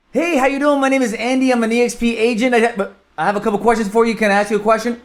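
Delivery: high, rapid-fire sales pitch; Speedy Gonzalez rush. Shown as a demonstration. Agent cold-calling a prospect, far too fast and high. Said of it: "be like the Speedy Gonzalez and go".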